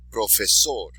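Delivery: Profesor with the final "sor" stressed; stress on last syllable. Shown as a demonstration. Teacher modelling words for students, last syllable of Profesor stressed. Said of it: "accent on the last syllable".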